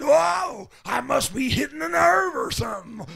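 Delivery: high, loud fired-up whoa; a shouted, triumphant whoa; excited exclamation. Retold or imitated. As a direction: burst out, thrilled you hit a nerve. Spurred on, exclaiming.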